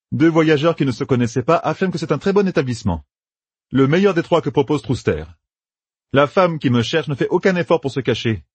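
planning aloud with brisk confidence; a hint of a young general laying out plans; Matt's voice. A narrator's reading. Narrator voices Matt.

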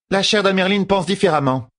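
high-pitched, firm reminder; pointed reminder. A narrator's reading. Fail reminding the sister of the Amyrlin's view, pointedly. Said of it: "rappela Fail".

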